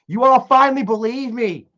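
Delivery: ordinary talk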